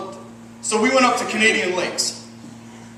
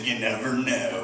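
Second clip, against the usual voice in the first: low, slow, softer, a hint of a laconic local; deadpan stranger; 7 semitones lower, 7 dB quieter, slower than usual. Comic voices the overalls guy at the gas station, lightly low and slow. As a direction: drop it low and slow like a man of few words.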